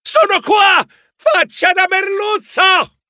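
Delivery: mustering courage, shouting at a shark; brave shout. Acted. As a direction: yell to draw the shark away. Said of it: "gridai con tutto il coraggio".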